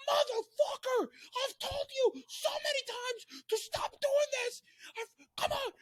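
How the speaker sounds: very high, held-back mock scream; slow, strained yell at reduced volume; imitated angry yelling. Friend hit in the eye yelling in the alley, mock scream. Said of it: "starts yelling in the alley".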